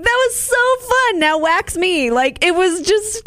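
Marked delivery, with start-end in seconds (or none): 0.0-2.1 light mock gushing, jumping high on that; mocking the couple. Host mimics couple after waxing, lightly voiced chirpy gush. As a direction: parody their chirpy eagerness. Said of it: "they were like".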